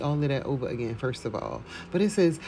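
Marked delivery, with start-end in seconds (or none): none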